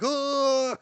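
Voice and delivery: high, loud falsetto oh; mock soul-drained cry. Mock cry of a victim losing his soul, high and loud. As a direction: wail it way up high like your soul is leaving. Highlander takes person's soul.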